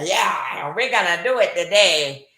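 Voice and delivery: lightly hammed-up Cuban bandleader swagger; light imitation of a self-important man; Ricky Ricardo voice. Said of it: "in his Ricky Ricardo voice".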